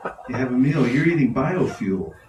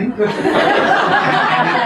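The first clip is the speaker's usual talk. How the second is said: laughing; 11 semitones higher, 8 dB louder, much slower than usual. Real, not acted. Laughing, excited high.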